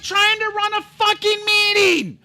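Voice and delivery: high, straining wail of frustration; venting about mom ruining the meeting, a high wail; exasperated wail. Retold or imitated. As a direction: let it break into a cry of disbelief. Exasperated outburst retold.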